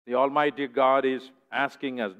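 ordinary delivery

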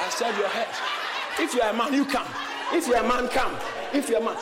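loud, mock-angry dare; fighting challenge. Man daring his insulter to come, angry challenge. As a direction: square up and shout him down.

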